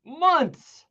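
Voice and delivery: high, punched "Months"; a hint of outrage; pointed emphasis. Shown as a demonstration. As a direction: hit it like a verdict. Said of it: "every word you could pause over".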